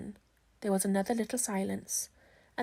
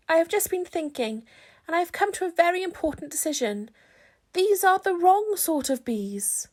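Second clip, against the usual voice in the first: higher, louder bear voice, earnest; Pooh voice; 7 semitones higher, 5 dB louder than usual. Acted. Pooh tells Christopher Robin the bees are wrong. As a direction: announce it like a bear with a big decision. Pooh's voice, held.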